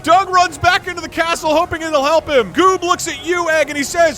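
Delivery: game master's scene setting. Acted. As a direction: narrate the dash with rising drama.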